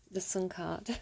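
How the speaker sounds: low teasing whisper; sexy whisper. Pretend. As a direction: whisper it like a flirt. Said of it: "time for story time".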